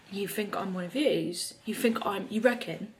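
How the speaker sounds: playful mock British accent, gasping in delight; hammed-up British accent, pleased; fake British accent. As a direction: put on a cheeky fake British accent, thrilled. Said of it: "doing a fake British accent".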